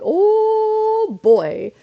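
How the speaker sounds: put-on long, high, loud "oh" then boy; hammed-up wide-eyed alarm; stunned reaction. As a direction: draw out a dramatic oh boy. Reaction to the escalated fight.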